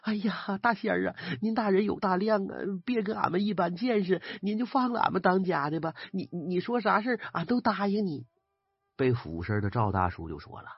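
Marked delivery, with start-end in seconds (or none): 0.0-8.3 higher, pleading peasant woman begging the spirit; high pleading voice of a frightened wife. A narrator's reading. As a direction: beg on your knees for your husband's life. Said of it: "跪在地上连连磕头，嘴里念叨着".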